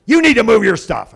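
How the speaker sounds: loud, high imitated cashier; high-pitched bossy command; curt order.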